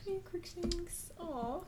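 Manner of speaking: quiet, high little aside; hushed, high-pitched pet talk; soft cooing to a pet. Real, not acted. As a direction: coo softly to the cat.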